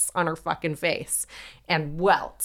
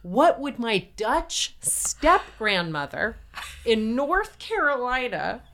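slow, deliberate test question; mock-solemn challenge; 7 semitones higher, slower than usual. John's friend tests the witch, mock-solemn challenge. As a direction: climb higher as the question unfolds.

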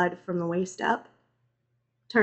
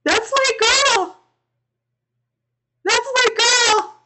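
very high, drawn-out loud wail; anguished scream; 8 dB louder than usual. A narrator's reading. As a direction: scream in desperation. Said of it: "I screamed".

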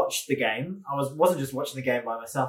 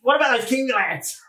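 excited dad voice; 10 semitones higher, 4 dB louder than usual. Retold or imitated. Imitating dad's enthusiasm.

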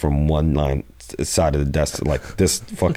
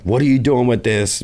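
accusing cop; 8 semitones higher, 3 dB louder than usual. Retold or imitated. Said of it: "they were like".

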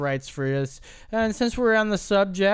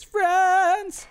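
squeaky high voice; 13 semitones higher, 3 dB louder than usual. Acted. Repeating the same voice.

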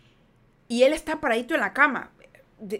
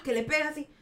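ordinary talk

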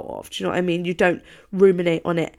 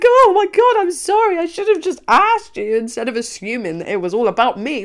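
much higher and louder, overly sorry; gushing mock apology; 8 semitones higher, 6 dB louder than usual. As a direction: apologise in a rush of high sympathy. Retelling her gushing apology.

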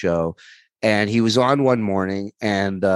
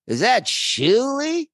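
high drawn-out call; slower than usual. Retold or imitated. Norm calling his name.

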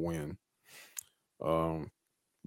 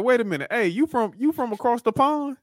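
high-pitched, mock-puzzled comic voice; R-Truth; 14 semitones higher than usual. Host retelling R-Truth quizzing his opponent, high mock puzzlement. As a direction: pitch it way up, imitating a confused questioner. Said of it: "And he's like".

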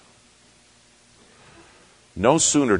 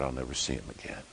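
quiet thought; 4 semitones lower, 13 dB quieter, faster than usual. Retold or imitated. Grieving thought.

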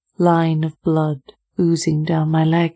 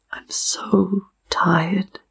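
slow breathless whisper, almost no voice; exhausted whisper; much slower than usual. Acted. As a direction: let it out as a worn-out whisper. Exhausted girl speaks to officer.

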